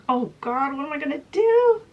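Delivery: high, loud, rushed wail of panic; panicked. Acted. Murderer panicking as the noise increases, high panicked outburst. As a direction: rush it out, climbing to a desperate cry.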